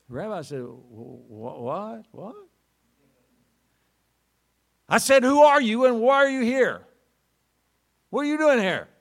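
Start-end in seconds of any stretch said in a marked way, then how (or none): 0.6-2.4 startled stammer. Acted. 4.9-6.9 loud, high repeated shout; shouting soldier. Acted. Soldier repeating his challenge to the rabbi, loud shout. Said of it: "the bark of a Russian soldier". 8.1-8.9 loud, high repeated shout; shouting soldier. Acted. Soldier repeating his challenge to the rabbi, loud shout.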